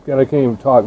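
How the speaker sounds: normal talking voice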